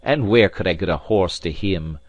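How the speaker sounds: loud, indignant retort; show of fury. A narrator's reading. Alan turns on the maid about riding, feigning fury. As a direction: round on the listener in loud mock fury. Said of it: "with the same appearance of fury".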